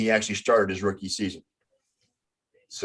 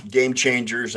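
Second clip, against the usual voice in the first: ordinary talk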